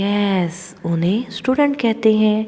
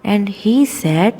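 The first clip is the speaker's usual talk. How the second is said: ordinary talk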